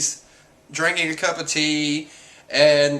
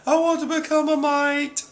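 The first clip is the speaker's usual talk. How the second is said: high-pitched mock wail; retold wail; 13 semitones higher than usual. Retold wail of demoralized marines.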